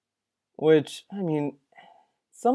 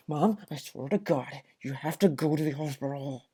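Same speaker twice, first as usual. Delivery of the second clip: much softer mock scolding; mock hushed plea; 3 semitones lower, 12 dB quieter than usual. Streamer parodies what the son told his mom, much softer. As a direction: hush it, parodying him. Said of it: "You'd probably be like".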